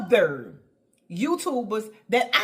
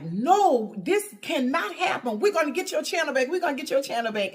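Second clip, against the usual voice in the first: fast, higher imitation of a friend's accent; Dee Dee's accent; 6 semitones higher, faster than usual. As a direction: rattle it off in her friend's accent. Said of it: "you know I love her accent".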